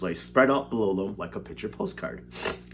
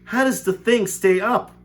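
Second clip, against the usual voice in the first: frightened cry; 11 semitones higher, 7 dB louder than usual. A narrator's reading. Grandpa Joe frightened.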